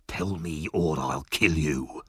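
low, slow, quiet menace; menacing threat. A narrator's reading. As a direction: threaten him in a low, deadly voice.

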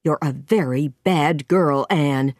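mildly stern scolding, on the low side; understated cross older guardian; angry scolding. A narrator's reading. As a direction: scold with restrained anger. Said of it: "Marilla said angrily".

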